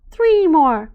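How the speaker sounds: high, loud eager call; excited shout. A narrator's reading. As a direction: shout it gleefully. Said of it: "Kamara says".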